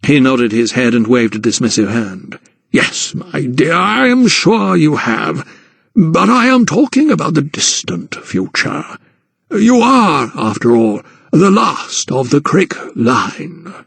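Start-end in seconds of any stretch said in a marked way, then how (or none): 2.7-13.7 a hint of an old gentleman, higher and slow in places; lightly voiced patronising guardian; Sir Montague, lightly voiced. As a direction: narrator lightly playing a condescending old aristocrat.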